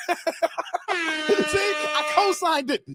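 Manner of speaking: ordinary talk, nothing marked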